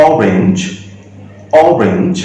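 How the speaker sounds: অরেঞ্জ said on its own, opening on a clear "aw"; অরেঞ্জ as a separate word, first vowel "aw"; pronunciation demo. Shown as a demonstration. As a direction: model অরেঞ্জ with an open "o" first sound. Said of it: "প্রথম উচ্চারণ কিন্তু অ হচ্ছে".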